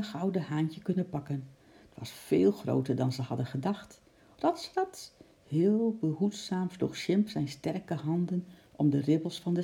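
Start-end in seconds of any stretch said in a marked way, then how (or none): 4.4-5.1 high, light scurrying "Rats, rats"; quick scrambling sound. A narrator's reading. Narrator voices the quick climbing sound high. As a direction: make it sound like scrambling paws.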